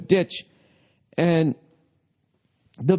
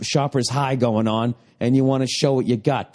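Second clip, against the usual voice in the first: ordinary talk, nothing marked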